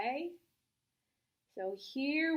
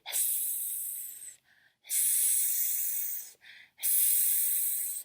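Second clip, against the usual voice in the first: unvoiced, soft hissing; snake hiss; 15 dB quieter, much slower than usual. Shown as a demonstration. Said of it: "A snake says".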